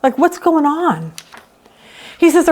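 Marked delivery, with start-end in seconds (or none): 0.0-1.1 much higher, disbelieving question upward; incredulous high question. Retold or imitated. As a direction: ask if God is really asleep. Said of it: "You're opening the curtain saying".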